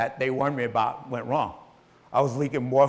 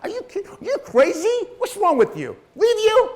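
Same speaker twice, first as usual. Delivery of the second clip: high-pitched, louder mock outrage from the wife; wife's indignant retort; 6 dB louder than usual. Wife scolding her sick husband for saying leave, high indignant. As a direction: squeal it back like she can't believe her ears.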